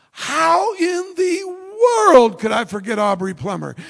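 high, drawn-out wail of disbelief; mock exasperated outburst. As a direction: let the held-back reaction burst out slowly. Outburst he held back, after meek answer.

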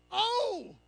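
a high, soft, drawn-out sigh of longing "Oh". Shown as a demonstration. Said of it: "that's a different kind of oh".

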